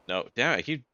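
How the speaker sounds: usual speaking voice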